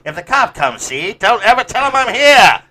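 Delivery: high, loud hammed-up mobster voice; 1930s gangster. Friend mocking the story as a hiding gangster, gangster voice. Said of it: "nineteen thirties gangster".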